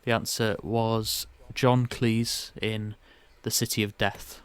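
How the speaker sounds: ordinary talk, nothing marked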